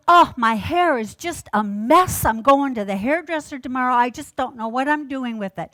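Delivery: the neighbor waving off praise, loud and flustered; fretting neighbor. Retold or imitated. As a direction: brush off the compliment in a flustered rush. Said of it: "And she goes".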